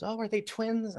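high-pitched, lilting question; curious neighbor. Retold or imitated. Neighbor asking a dad about his kids, curious. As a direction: imitate a sweetly curious passer-by.

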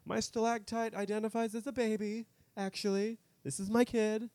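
high put-on mom voice, a touch defensive; mock indignant mother. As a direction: insist the rock is your baby in a high mock voice. Mocking woman claiming stalactite baby.

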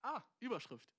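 ordinary talk, nothing marked

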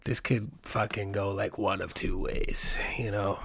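slow hushed whisper of inner fear; whispered worry. Retold or imitated. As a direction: breathe it out, scared of the approaching cop. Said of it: "I'm like".

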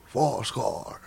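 slow, whispered impression; Sean Connery. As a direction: whisper it slowly as an impersonation. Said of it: "Sean Connery".

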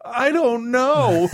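high, slow, mock-helpless I don't know; drawn-out I don't know. To the therapist asking how she is, a high, slow shrug of an answer. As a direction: sing it out slowly, high and lost. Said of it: "the answer to that is".

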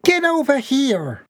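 very high mother's demand; very high mother's command; chasing mother. A narrator's reading. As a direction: call her son to come, exasperated. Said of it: "mother chases son".

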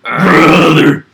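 loud gritted-teeth "brother"; Fed Smoker. Retold or imitated. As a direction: bark it through clenched teeth. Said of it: "Gotta call everybody brother".

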